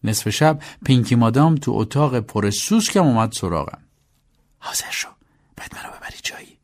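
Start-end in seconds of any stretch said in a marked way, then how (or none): 4.6-6.6 hushed, whispered order. Acted. Pinky Madam wakes her driver at midnight, whispering. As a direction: whisper it low so nobody else hears.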